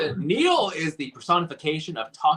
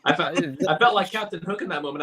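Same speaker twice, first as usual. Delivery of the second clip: usual speaking voice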